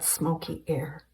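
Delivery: plain reading voice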